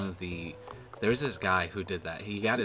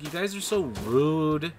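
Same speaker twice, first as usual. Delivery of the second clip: higher, louder, slow "so rude"; indignant retold scolding; 8 semitones higher, 7 dB louder, slower than usual. Retelling the players who left the call, slow indignant scolding. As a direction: scold them, dragging it out. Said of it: "I was mad".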